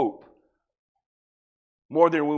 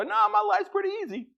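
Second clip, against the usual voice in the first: mock objector; 3 dB quieter than usual. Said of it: "throw me an argument back and go".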